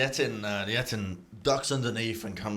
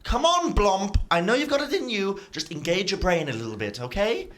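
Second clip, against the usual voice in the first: a hint of a patient schemer, rising high; scolding head; 8 semitones higher, 4 dB louder than usual. GM voices the smart head lecturing its twin. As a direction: coax the other head along.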